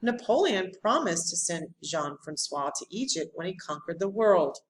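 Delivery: usual speaking voice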